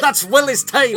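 high, loud, slow Scottish; big hammed-up Scottish burr; Scottish accent. Joining friend's groundskeeper bit, loud Scottish accent. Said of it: "that's a good Scottish accent".

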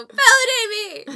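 high loud drawn-out wail; yelling. Retold or imitated. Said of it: "I just go".

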